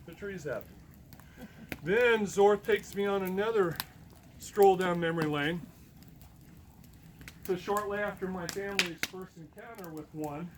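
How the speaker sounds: ordinary delivery, nothing marked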